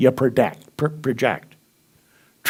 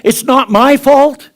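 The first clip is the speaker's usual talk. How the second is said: mock whining excuse; 9 semitones higher, 6 dB louder, slower than usual. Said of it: "You ever heard that?".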